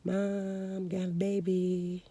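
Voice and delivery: tiny, drawn-out whispered confession; super whisper. Retold or imitated. Daughter in the corner telling mom she's pregnant, super whisper. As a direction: whisper it almost inaudibly, slowly. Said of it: "just super whispering".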